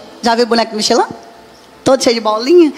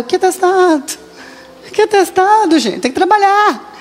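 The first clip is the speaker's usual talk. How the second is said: high, brushing-off retort; mock-indignant high retort to the church folks; dismissive retort; 7 semitones higher than usual. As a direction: wave the worry away. Brushing off worried church people.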